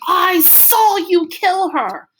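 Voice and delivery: high, loud, slow cry of accusation; accusing shout. A narrator's reading. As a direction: hurl it at him, breaking. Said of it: "and I'm going".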